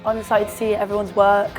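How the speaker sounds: ordinary talk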